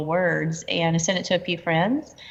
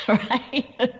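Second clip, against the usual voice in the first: laughing it off mid-sentence; real amused laugh while talking; 5 semitones higher, slower than usual. Real, not acted. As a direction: giggle through the words. Laughs at own compulsion to create.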